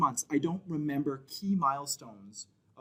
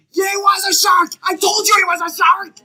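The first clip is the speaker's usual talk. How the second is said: put-on very high, full-volume kid shout; little boy yelling; 15 dB louder than usual. Boy running to tell other kids about the shark, high-pitched yelling. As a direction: yell it like an excited child across a beach.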